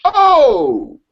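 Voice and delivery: surprised. Real, not acted. Mock shock at news.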